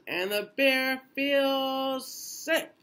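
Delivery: high, slow sing-song refrain line; drawn-out refrain. A narrator's reading. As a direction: stretch the refrain up high for the kids.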